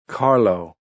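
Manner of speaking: put-on loud drunken scream into the dark. A narrator's reading. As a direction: yell his name across the street. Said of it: "Sigismundo screamed into the murk".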